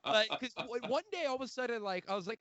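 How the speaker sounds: usual speaking voice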